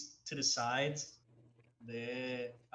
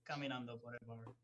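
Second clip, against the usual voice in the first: ordinary talk, nothing marked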